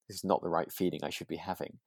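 normal talking voice

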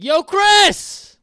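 high, very loud holler; scream across store. Acted. Calling a friend from across Walmart, full scream. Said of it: "I would definitely scream across Walmart".

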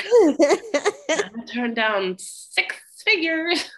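high, slow laughing boast; laughing mock brag. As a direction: stretch it out with a giggle. Mocking Stella's boastful voice.

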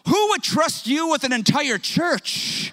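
hammed-up disbelief from the man at lunch; incredulous stranger. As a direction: look him up and down and scoff. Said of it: "He goes".

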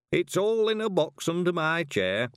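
gently voiced old man; frail old man. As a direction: speak as a frail old man asking for his box. Old Mr Weevil asks Tiffany.

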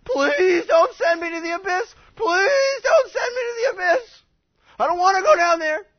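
high, shouted, wailing mock plea; frantic begging. Demons begging Jesus not to send them to the abyss, frantic pleading. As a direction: play terrified begging at full volume. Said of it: "had begged Jesus".

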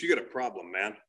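low-pitched retold challenge; retold confrontation. Comic retelling neighborhood saver at mom's door, low challenge. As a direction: imitate a guy confronting you, voice dropped.

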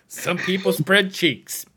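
slow, drawn-out punchline. Shown as a demonstration. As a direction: land the punchline slowly. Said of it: "delivering the punchline".

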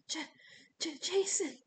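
high, stammered name; Piper barely managing. A narrator's reading. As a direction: stutter it out in shock. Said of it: "Piper managed".

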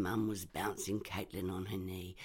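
ordinary delivery, nothing marked

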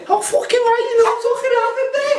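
put-on high, laughing Liverpool accent; mock Scouse accent. Accent game, mocking Liverpool, high and laughing.

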